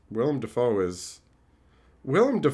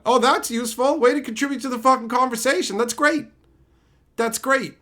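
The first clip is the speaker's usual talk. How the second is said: sarcastic retort; 11 semitones higher, 4 dB louder than usual. Retold or imitated. Mock sarcastic reply to Luke.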